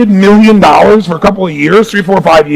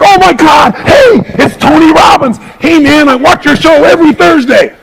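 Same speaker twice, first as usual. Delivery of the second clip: fans spotting a TV celebrity, gushing high excited shout; starstruck fan; 8 semitones higher than usual. Retold or imitated. A fan recognizes the speaker from TV and calls out, excited and high. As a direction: squeal it like you just spotted a star on TV. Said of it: "they treat you like a celebrity".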